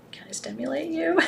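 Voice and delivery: playful suggestive whisper; teasing whisper. Pretend. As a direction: whisper it like a cheeky flirt. Flirty joke to audience.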